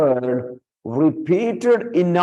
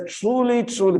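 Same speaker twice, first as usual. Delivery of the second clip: first "truly" pitched much higher, second still raised; emphatic repetition; 9 semitones higher than usual. Shown as a demonstration. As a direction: fling the first "truly" up, then echo it. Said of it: "it is so important".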